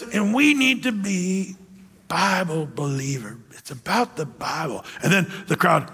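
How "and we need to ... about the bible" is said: preacher whispering into the mic; hushed preacher. Retold or imitated. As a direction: breathe the words like a preacher drawing them in. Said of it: "And then he says".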